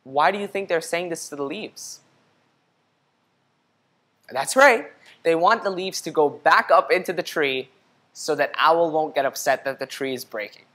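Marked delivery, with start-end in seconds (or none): none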